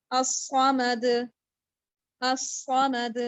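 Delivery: الصمد ending with a firm bounced د; strong qalqalah. Shown as a demonstration. Teacher demonstrating tajweed, firm qalqalah on الصمد. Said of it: "strong قلقلہ".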